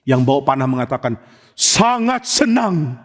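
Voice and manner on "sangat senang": tearful, moved. Acted. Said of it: "sampai mau nangis".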